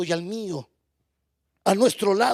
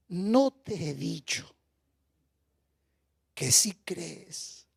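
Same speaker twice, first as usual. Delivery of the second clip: slow, low, gentle reminder; tender rebuke; 6 semitones lower, 5 dB quieter, slower than usual. Acted. Jesus gently reminding Martha to believe, slow tender voice. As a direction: coax her softly, like a patient friend.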